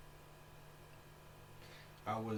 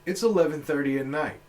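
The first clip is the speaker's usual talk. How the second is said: acted rant, loud and pitched up; exasperated loud retort; 6 semitones higher, 12 dB louder than usual. Acting his answer to the man outside the hotel, loud exasperation. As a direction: lay out the reasons at high volume. Imagined retort to heckling stranger.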